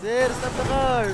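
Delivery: high, loud yell across a distance; loud desperate call after the car; shouting after a car. Acted. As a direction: bellow to make the driver stop.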